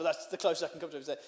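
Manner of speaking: normal talking voice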